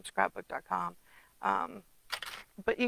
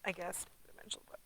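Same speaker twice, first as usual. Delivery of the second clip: whisper; 17 dB quieter, faster than usual. Real, not acted. Hedging aside.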